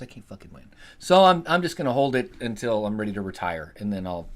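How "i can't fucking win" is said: defeated whisper. Retold or imitated. Retelling his trading luck to viewers, defeated whisper. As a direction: whisper it, beaten. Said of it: "I'm like".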